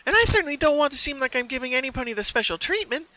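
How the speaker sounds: high, bright-toned cheerful pony voice; high cheerful cartoon pony; Pinkie Pie voice. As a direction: play the sweet pony with a sinister calm.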